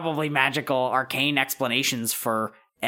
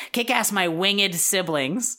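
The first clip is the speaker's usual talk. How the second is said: higher, emphatic dictation; Wendy; 7 semitones higher than usual. Acted. Friend dictating a message to send, higher voice. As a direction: settle on the final wording, pitched up. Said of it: "say, no, no".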